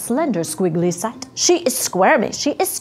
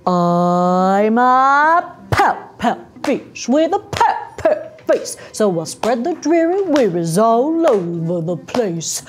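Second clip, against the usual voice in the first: gloomy pout pout fish. Acted. Glum fish announcing itself in a picture book, theatrical chant. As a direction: stretch the opening, then pop the rhymes.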